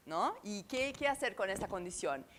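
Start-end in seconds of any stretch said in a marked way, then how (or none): none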